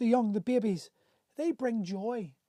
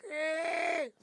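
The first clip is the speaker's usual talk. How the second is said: higher-pitched mocking sneer at a man showing feelings; derogatory jeer; 6 semitones higher, 3 dB quieter, much slower than usual. Imitating a mocker jeering at men who talk about love, derisive. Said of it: "all these derogatory tones".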